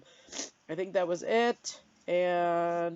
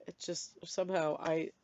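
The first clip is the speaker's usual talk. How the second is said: normal talking voice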